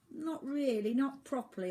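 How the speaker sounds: put-on boy voice insisting; protesting. A narrator's reading. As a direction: object as the young boy. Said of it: "Oliver protested".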